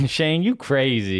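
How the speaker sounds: slow, teasing imitation of a tickled crowd; imitated audience member.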